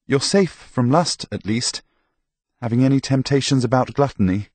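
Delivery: a hint of a priest checking on a patient; lightly voiced priest. Priest going through sins with sick Tomas, lightly voiced.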